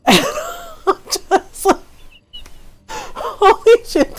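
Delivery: cracking up at her own voice; laughing. Real, not acted. Streamer laughing at her impression, cracking up.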